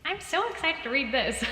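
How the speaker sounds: self-mocking chirpy gush; mock excited. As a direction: gush it like a giddy reader. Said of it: "Me saying that as I'm all like".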